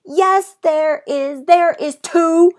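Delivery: high, loud protesting yell; Junie B. yelling. A narrator's reading. Child yelling at a bully on the bus. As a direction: shout back at the mean boy. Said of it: "I yelled".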